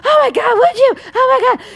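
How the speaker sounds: very high-pitched imitation of a frantic woman; mock desperate gratitude. Mermaid to the helper offering another tail, high frantic gratitude. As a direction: squeal it out as the rescued mermaid. Retold mermaid gushing with relief.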